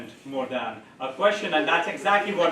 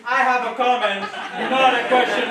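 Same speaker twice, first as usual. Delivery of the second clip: high, loud, laughing echo of the phrase; laughing mimicry; 6 semitones higher, 3 dB louder than usual. Host laughingly parrots the player's line to the audience, high and loud.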